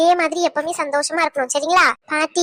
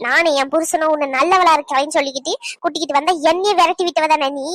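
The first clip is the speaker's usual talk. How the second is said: ordinary talk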